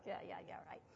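sceptical "yeah, yeah, yeah", whispered; skeptical whisper. Retold or imitated. The doubtful mom scoffs under her breath, whispered. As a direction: mutter it in disbelief. Mom's doubtful look voiced.

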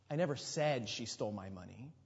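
"said" leaned on, questioning lift at the end; emphasize "said" above all other words; stress on SAID. Shown as a demonstration. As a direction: put the weight on "said". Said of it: "emphasize a different word".

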